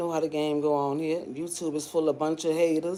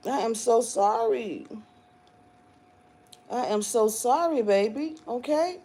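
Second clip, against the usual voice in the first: mock apology; 6 semitones higher than usual. Mocking apology to haters.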